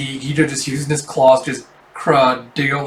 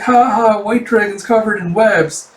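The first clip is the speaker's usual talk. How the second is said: mocking laugh; 7 semitones higher, 4 dB louder than usual. Mocking a friend in webs.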